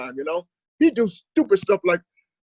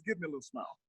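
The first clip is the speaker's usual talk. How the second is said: much softer mock coaxing; softer coaxing dad; 5 semitones lower, 15 dB quieter, faster than usual. Dad coaxing his son after spanking, hushed. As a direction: murmur dad's line after the spanking. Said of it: "he says".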